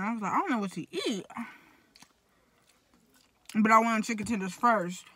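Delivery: eating between words; talking while chewing. Real, not acted. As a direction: mouth partly full of fries. Seen 0: fries put in mouth, chewing visible between phrases throughout.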